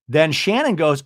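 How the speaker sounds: ordinary delivery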